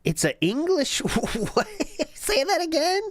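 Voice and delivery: slow, drawn-out disbelief, pitch climbing high; can't believe what he just heard; incredulous. Real, not acted. Co-host questioning the streamer's odd phrase, incredulous.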